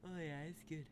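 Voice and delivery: ordinary talk, nothing marked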